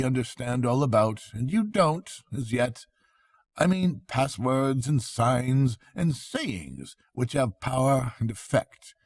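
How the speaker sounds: a wise friend gently scolding and teaching; kindly Rat. Acted. Rat lectures the Mole.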